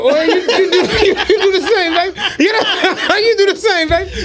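high-pitched, breaking up with laughter; laughing reply. Retold or imitated. Young man answers the old head's good day, laughing high. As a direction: crack up while returning the pleasantry.

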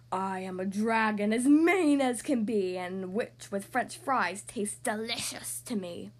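loud, menacing dragon voice; scary roaring dragon. Acted. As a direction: roar it slowly as a hungry dragon. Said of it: "a roar that was scary and loud".

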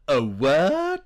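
mock-affronted, pitch climbing into a question; offended disbelief. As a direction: repeat it as an appalled question. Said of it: "gear shift from".

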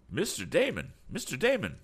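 high-pitched call of recognition. A narrator's reading. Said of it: "cried Tom".

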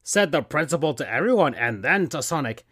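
plain reading voice

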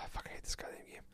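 soft, voiceless whisper of frustration; whispered sigh. Real, not acted. Gamer muttering to himself about the hated game, whispered.

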